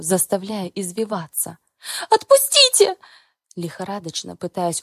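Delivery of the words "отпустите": high loud screaming plea; panicked scream. Acted. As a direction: scream to be let go. Heroine screams to be released.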